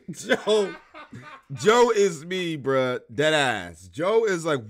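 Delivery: loud, laughing-through-it rant; real excitement; excited. Real, not acted. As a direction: still giddy, keep the energy up. Still laughing about Joe.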